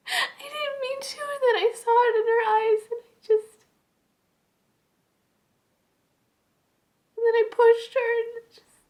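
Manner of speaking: very high, choked, tearful confession; horrified sobs, voice squeezed high; sobbing confessing woman. Acted. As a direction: break down crying, gasping between phrases. Acted confession monologue.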